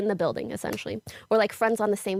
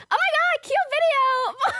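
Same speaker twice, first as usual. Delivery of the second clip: gushing fan voice; slower than usual. Retold or imitated. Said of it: "my whole community is like".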